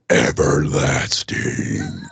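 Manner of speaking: very low, slow, drawn-out word; mock-ominous voice. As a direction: intone it like a dark prophecy. Mock-grand voice for robot's eternal life.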